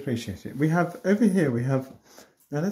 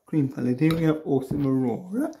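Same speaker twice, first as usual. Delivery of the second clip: ordinary talk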